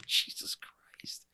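breathless whisper. Real, not acted. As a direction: whisper it in disbelief. Stunned reaction.

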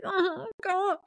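high, crying "oh God" with a sob-laugh; mock crying. Nancy hurt by Chris, mock crying. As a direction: imitate her breaking into tears. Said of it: "starts kinda, like, crying".

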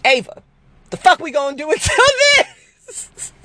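squeaky high voice breaking into laughter; half laughing, half scolding; sister laughing. Retold or imitated. Sister laughing at her motivational speaker dream. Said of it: "she was like".

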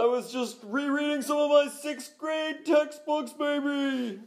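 high, loud hammed-up sports shouter; hyped-up caller shtick. A caller bursting onto the show, hammed-up high shout. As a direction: belt it out, stretching the final word high.

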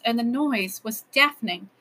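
plain reading voice

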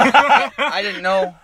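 slow, high, laughing disbelief; laughing mock awe. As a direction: gasp it out through laughter. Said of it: "mock awe".

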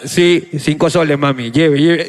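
deep, steady market sales patter; recorded vendor loop. Retold or imitated. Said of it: "volvió a pasar la señora".

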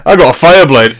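high, slow, laughing brag; hammed-up swooning boast; mock proud boast. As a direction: savour the words like a proud owner.

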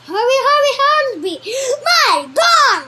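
high loud repeated yell; frantic Duke yelling; frantic shout. Acted. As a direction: scream for the gun. Duke frantic for his gun.

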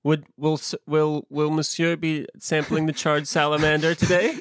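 hint of a posh waiter voice; politely serve the charred salamander; snooty waiter. Mock waiter serving charred salamander.

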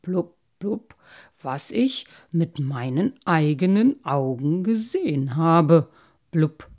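loud, emphatic carp with blubs; ponderous insistence on honesty; earnest protesting. A narrator's reading. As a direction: swear it weightily, bubbling between phrases. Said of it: "beteuerte der Karpfen".

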